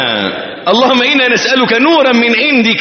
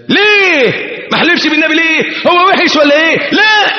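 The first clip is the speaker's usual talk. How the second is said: protesting questioner; 10 semitones higher than usual. Acted. Mock questioner protests about swearing.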